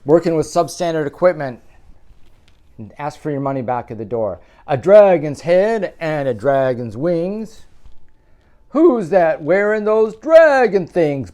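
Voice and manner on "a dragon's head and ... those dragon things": loud, lilting picture-book read; reading to children. A narrator's reading. Parent reading a Halloween book aloud, sing-song. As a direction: read it out big for little ones. Storybook narration.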